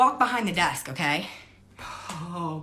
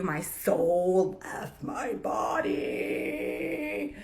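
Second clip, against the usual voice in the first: very slow stunned drawl; 4 dB quieter, much slower than usual. Real, not acted. Stunned aside.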